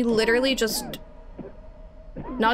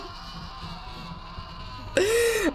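long, high, breathless scared laugh; nervous laughter; 14 semitones higher, 5 dB quieter than usual. Real, not acted. Player as the monster closes in, panicked laughter. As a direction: laugh helplessly from fright.

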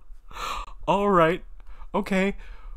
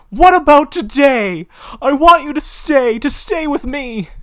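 loud, high pleading shout; a desperate high yell begging him to stay; insistent shout; 14 semitones higher, 14 dB louder than usual. Acted. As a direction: demand that he stay, full force. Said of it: "John insisted".

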